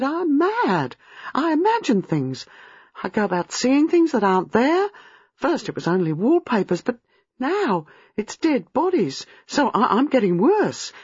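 put-on high, overwrought young woman; agitated, high-pitched self-accusation; despairing. A narrator's reading. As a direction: insist in mounting panic. Despairing that she is going mad.

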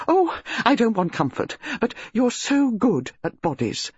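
high, thrilled country lady; gushing lady relishing a scandal; excited upper-class lady. Acted. Mrs Bantry excited.

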